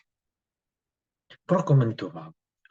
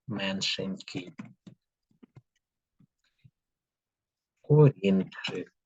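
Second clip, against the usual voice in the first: slow dictation; slower than usual. Shown as a demonstration. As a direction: say it again slowly to check. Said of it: "І записуємо слова". (повільне повторення диктанту)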